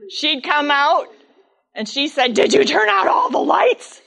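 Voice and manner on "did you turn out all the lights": exasperated wife. Retold or imitated. Mother scolds father.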